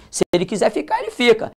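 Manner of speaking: normal talking voice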